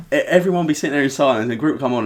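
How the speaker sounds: ordinary delivery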